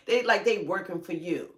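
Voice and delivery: ordinary talk, nothing marked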